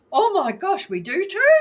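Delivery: high, loud mock astonishment; retold shocked realisation. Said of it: "She covered her mouth and said".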